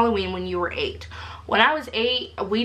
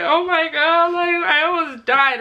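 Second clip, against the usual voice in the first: retold crying; 8 semitones higher, 3 dB louder than usual. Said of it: "I'm over here crying".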